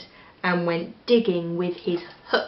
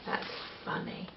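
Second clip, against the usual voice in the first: soft unvoiced aside; hushed amused aside; whisper; 17 dB quieter, faster than usual. Real, not acted. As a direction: murmur it privately to the listener. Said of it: "reader aside whisper".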